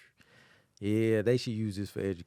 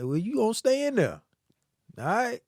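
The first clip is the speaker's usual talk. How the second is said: higher, louder mock dad; dad's voice; 11 semitones higher, 4 dB louder than usual. Teller voicing his dad refusing to bail him out. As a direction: play the dad leaving him there. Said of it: "And he was like".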